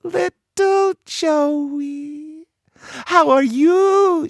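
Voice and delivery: very high, slow, cooing greeting; sugary sister. A narrator's reading. Annie cooing to her little brother Joey, slow and high. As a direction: coo at your little brother.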